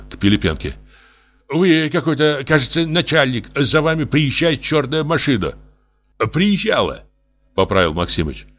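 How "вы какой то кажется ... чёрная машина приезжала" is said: higher pleading professor voice; lightly voiced anxious professor; tipsy professor. Professor addresses the boss about a car, anxious higher voice.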